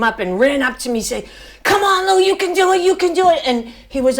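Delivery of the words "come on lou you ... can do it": high, loud coach cheering; coach yelling. Retold or imitated. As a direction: yell it right in the runner's ear. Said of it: "yelling in my ear".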